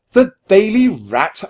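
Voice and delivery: high, loud, slow disbelief; stretched-out, high-pitched exclamation; shocked squeak. A narrator's reading. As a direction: squeak it out in outrage. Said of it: "I squeaked".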